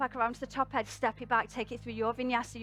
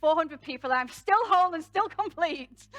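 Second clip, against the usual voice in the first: mock proud declaration; 4 semitones higher, 6 dB louder than usual. Voices Rumi owning the mistake.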